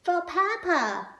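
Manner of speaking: high, slow fan voice; high mock fan voice; fan's note. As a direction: read the sign sweetly. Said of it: "This one says".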